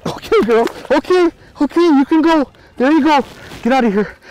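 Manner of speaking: high, bright coaxing of the fish. Real, not acted. Releasing the fish.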